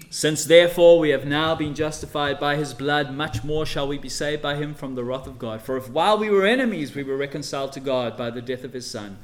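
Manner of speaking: loud proclaiming read; preaching at full force. Acted. Preacher reading Romans to the church, loud proclaiming. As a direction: declare it to the church.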